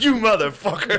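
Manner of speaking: high, loud laughing curse; hammed-up fury through a laugh; mock outrage. Shot husband cursing the intruder in laughing mock rage.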